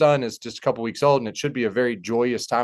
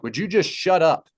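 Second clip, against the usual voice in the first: exasperated retold command, pitched up; raised voice, only half yelled; mild retold yell; 6 semitones higher than usual. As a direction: imitate someone kinda yelling, without full force. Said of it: "he kinda yelled at me".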